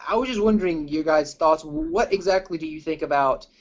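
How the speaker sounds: ordinary talk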